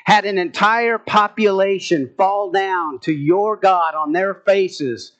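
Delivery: usual speaking voice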